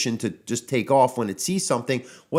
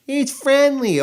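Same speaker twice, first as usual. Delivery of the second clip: mock high sing-song "it's friendly", louder; careless dog owner; 7 dB louder, slower than usual. Mocking careless owners, high breezy "it's friendly". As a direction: mimic the oblivious owner's breezy excuse, pitched way up. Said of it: "always gonna be followed by or preceded by".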